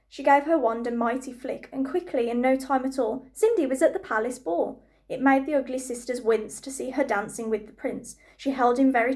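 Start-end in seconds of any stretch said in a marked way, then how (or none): none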